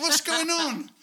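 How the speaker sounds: high, loud confused question; shocked question. Retold or imitated. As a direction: sound startled. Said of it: "And I'll be like".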